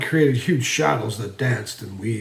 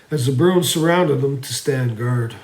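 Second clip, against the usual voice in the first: usual speaking voice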